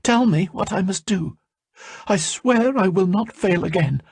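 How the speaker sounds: high, earnest plea; pleading servant. A narrator's reading. Salmataya on her knees begging, high earnest voice. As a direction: beg for the task.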